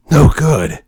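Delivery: loud gruff soldier impression; Snake voice. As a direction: growl it like a tired commando. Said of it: "one last Snake voice".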